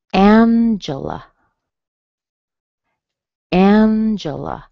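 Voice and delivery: slow "Angela" as AAN-juh-luh; strong first syllable. Shown as a demonstration. Teacher giving an example, first-syllable stress on "Angela". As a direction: stretch and raise the start of "Angela", two weak vowels. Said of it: "It is strong in the beginning".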